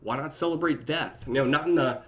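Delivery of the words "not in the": ordinary talk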